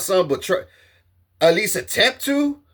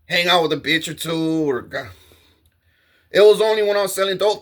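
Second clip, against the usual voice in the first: ordinary talk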